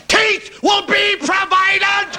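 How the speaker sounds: very high, slow shouted pronouncement; Paisley preaching. Retold or imitated. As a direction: boom it from the pulpit. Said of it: "And Paisley said".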